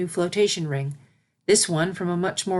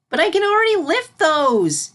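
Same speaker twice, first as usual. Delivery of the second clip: put-on high, louder indignant child; declaring; 11 semitones higher, 6 dB louder than usual. Filly protesting to Celestia, high and indignant. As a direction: insist proudly like a filly. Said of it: "declared Sunset".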